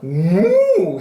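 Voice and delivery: long, loud cow moo. A narrator's reading. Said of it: "said the cow".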